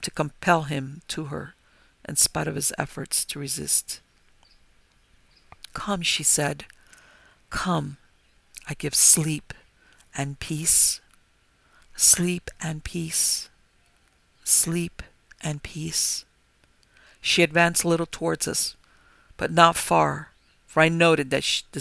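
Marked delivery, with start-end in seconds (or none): 5.7-16.3 slow, hushed, spellbinding lure; soft soothing call. A narrator's reading. Vampire luring the men into her trance, soft and soothing. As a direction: coax them in like a lulling spell. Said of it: "a soft, soothing voice".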